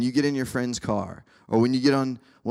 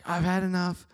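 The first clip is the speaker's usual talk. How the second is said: fed-up friend; 9 semitones higher than usual. Retold or imitated. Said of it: "And they're just like".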